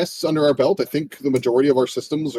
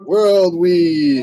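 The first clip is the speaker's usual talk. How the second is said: louder, higher announcer hype; hype announcement; 5 semitones higher, 5 dB louder than usual. Acted. Hosts hyping their next game to viewers, excited. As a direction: announce it like a trailer.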